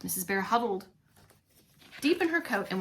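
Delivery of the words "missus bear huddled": plain reading voice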